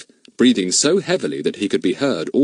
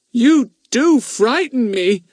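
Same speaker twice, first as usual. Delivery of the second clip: high, slow, wide-eyed mock fear; mock frightened; 5 dB louder, slower than usual. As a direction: feign being scared, teasing. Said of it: "making his eyes go big".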